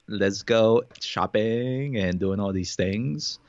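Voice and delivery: put-on ditzy valley girl gushing. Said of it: "the Valley girl, like".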